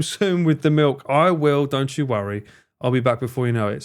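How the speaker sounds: normal talking voice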